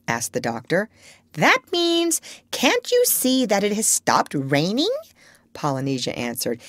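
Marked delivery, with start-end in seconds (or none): 1.3-5.0 high parrot translating; high-pitched, sure-of-herself parrot; know-all parrot. A narrator's reading. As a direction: interpret for the doctor in a bird voice.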